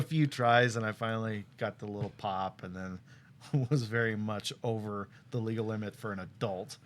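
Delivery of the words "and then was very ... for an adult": usual speaking voice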